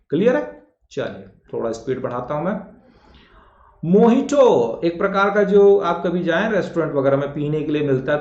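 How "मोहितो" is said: high, slow मोहितो; मोहितो with 'h' in the middle; correct pronunciation. Shown as a demonstration. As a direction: announce 'mo-hee-to' drawn out. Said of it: "वो मोहितो होता है".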